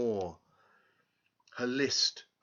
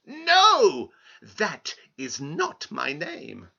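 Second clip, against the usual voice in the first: little man refusing; 3 semitones higher, 8 dB louder, much slower than usual. Acted. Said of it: "the man replied".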